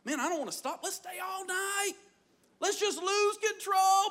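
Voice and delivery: giddy, high-pitched abandon; carried-away partier. Acted. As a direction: cry it out like a reveler who won't stop. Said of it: "it was so much fun".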